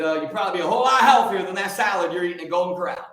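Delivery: ordinary talk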